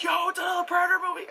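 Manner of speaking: high, fast fanboy squeal; excited realization. Retold or imitated. Retelling seeing the three dots in the trailer, excited. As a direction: blurt it out in high-pitched excitement.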